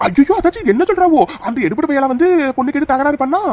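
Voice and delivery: horrified that he dared; outraged shock. Acted. Outraged at Marudhu.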